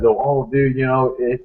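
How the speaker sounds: ordinary delivery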